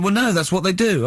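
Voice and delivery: lightly voiced Carl, bemused; mimicking Carl. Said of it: "he went".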